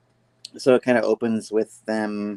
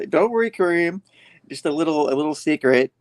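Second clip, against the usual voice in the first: playful Peter voice; 7 semitones higher than usual. Retold or imitated. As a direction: reassure him with a grin.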